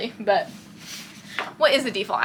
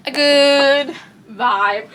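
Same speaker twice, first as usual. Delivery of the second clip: loud yawn stretching out GOOD; 3 semitones higher, 6 dB louder, much slower than usual. Real, not acted. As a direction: yawn right through the words, drawn out. Yawn interrupts, then apologizes.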